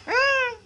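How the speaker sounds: high, loud startled yelp; sudden yelp. Real, not acted. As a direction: let out a sudden sharp cry. Sudden outburst after the talk.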